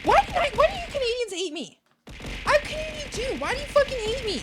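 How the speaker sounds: high, whiny fake offense; mock hurt whine. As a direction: play up being wounded by your own people. Mock hurt at Canadian viewers.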